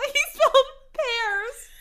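high, slow, strangled by laughter; laughing to tears. Real, not acted. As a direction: squeeze the words out through helpless laughter. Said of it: "I'm laughing so hard".

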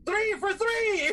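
high, slow announcer call; mock announcer. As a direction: stretch it out like a ring announcer.

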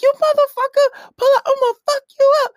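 imitated high-pitched yelling and cursing; loud, rapid, squeaky-high threats; Bad Baby. TikTok girl threatening someone, very high angry yelling. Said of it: "she's yelling and cursing".

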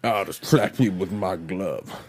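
low, lisping captain voice; slurring old captain. Acted. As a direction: threaten with a mushy slur. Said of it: "the speech impediment is growing".

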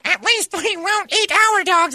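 very high, slow falsetto; mock shouting woman. Repeating the woman's parting shot, mock falsetto call. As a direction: imitate a woman calling out in squealing falsetto. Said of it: "Megan shouted in a tender voice".

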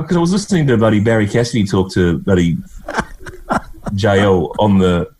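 ordinary talk, nothing marked

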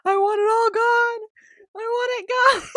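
high, loud, tearful wail as she retells it; retold crying wail. Retelling crying to mom in the car, high tearful wail. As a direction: replay the sobbing demand, half laughing at yourself. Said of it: "just crying and just being like".

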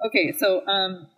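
ordinary talk, nothing marked

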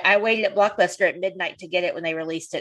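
ordinary talk

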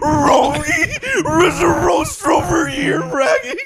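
very high, loud, slow cartoon dog; Scooby-Doo voice. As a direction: howl it out like a goofy cartoon dog. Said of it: "I can do Scooby-Doo too".

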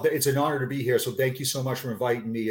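ordinary delivery

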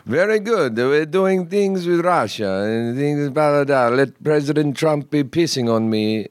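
lightly put-on Russian accent; a hint of a Russian guy. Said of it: "you gotta do it now".

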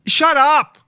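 high, loud snap of disgust; sharp shout. Real, not acted. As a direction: shout it down, fed up. Reacting to Instagram posts.

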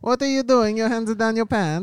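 put-on high, loud Borat accent; Borat impression. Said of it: "I'm running as Borat".

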